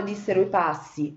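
usual speaking voice

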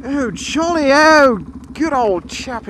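high, swooping mock-posh English; posh English accent. Rider commenting on his mate, put-on posh English accent. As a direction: play a jolly posh English chap. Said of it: "only posh".

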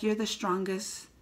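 soft, slow tender line. Retold or imitated. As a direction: say it gently, moved. Tender moment, softened.

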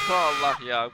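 startled loud cry; shout. Real, not acted. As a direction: blurt it out loud. Caught by monster.

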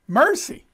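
"Mercy" lifted high with a rising tune; emphatic mercy. Real, not acted. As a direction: ring out the word "Mercy" in wonder. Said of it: "This is the context of grace".

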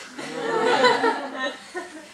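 very high squealing laugh; cracking up at own joke; high burst of laughter. Real, not acted. Laughing at his dwarf joke.

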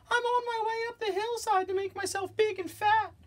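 tiny falsetto billy goat; very small voice. Acted. Smallest goat explaining himself to the troll, tiny high voice. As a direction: pipe it up very high, like the littlest goat. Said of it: "in his very small voice".

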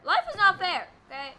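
high, loud protest; outraged yell. Real, not acted. Gamer protesting another loss, outraged yell. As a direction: cry it out as a bitter complaint. Protests after losing again.

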